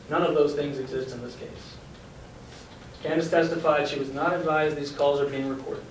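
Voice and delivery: ordinary talk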